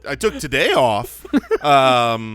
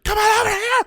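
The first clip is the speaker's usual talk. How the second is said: high, strained shout-whisper; whisper yelling; 4 dB louder, faster than usual. Shown as a demonstration. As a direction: call out while trying to stay hidden. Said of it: "are you yelling or are you whispering".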